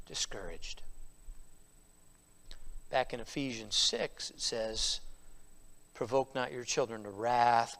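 low and soft from the pulpit; hushed, solemn preaching. Real, not acted. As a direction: let it sink in quietly. Solemn, hushed stretch of sermon.

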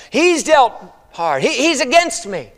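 high, drawn-out complaint; bitter accusation. Acted. Naomi complains God has turned against her, bitterly. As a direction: cry out against the hard treatment.

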